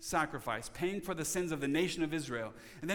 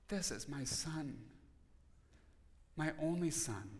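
tender; 8 dB quieter than usual. Retold or imitated. The Father's tender words.

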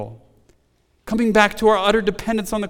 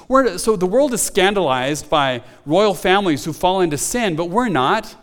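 ordinary delivery

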